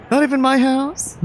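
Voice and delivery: put-on bright, upbeat young man; eager friend showing off the city; cheerful friend voice. Mark teasing.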